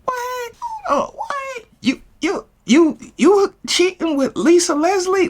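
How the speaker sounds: stunned squeak. Retold or imitated. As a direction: squeak it like you can't believe it. His shocked reaction.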